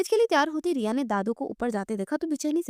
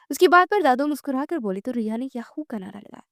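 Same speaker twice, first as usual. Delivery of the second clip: plain reading voice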